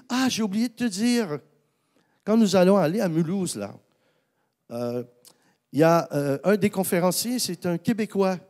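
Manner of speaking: put-on French accent. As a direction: play the French collaborator with a light French accent. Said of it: "excusez l'accent français". (léger accent français imité, voix plus aiguë)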